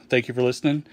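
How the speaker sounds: ordinary talk, nothing marked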